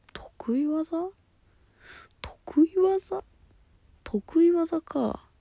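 high airy whisper, musing to herself; puzzled whisper. Real, not acted. Repeating the listener's question to herself, puzzled high whisper. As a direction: turn the question over in a high breathy hush.

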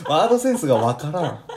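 laughing through the words. Real, not acted. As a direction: say it while cracking up. Laughing at odd word choice.

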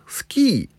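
スキー with a high pitch that falls away; trying out スキー. Shown as a demonstration. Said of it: "皆さんどういう？". (「スキー」を高く言ってみる)

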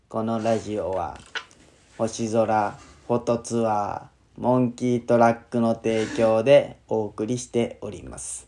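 slow, stiff robotic syllables; kindergartner-like talking. Acted. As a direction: spell out each word slowly like a child reading. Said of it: "その幼稚園児みたいな喋り方になる？".

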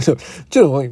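normal talking voice

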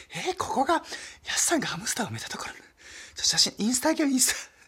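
high, breathy schoolgirl squeal; excited schoolgirl. Retold or imitated. Schoolgirls on a school trip at the spot, high and giddy. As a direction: gush like a teen tourist snapping photos. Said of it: "タクシーの運転手さんが女子高生に".

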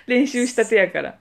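ordinary talk

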